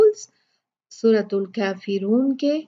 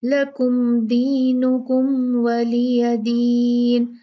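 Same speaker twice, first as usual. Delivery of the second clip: very slow chanted tajweed recitation; Quranic recitation; 3 dB louder, much slower than usual. Shown as a demonstration. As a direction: recite it, drawing out the madd. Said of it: "दोनों मीम को वाजे पढ़ना है लेकिन गुन्ना नहीं करना". (धीमी तजवीद वाली तिलावत)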